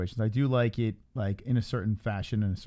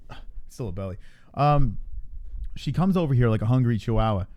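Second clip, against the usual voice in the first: usual speaking voice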